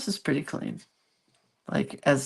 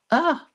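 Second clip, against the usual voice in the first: startled yelp; 10 semitones higher than usual. Real, not acted.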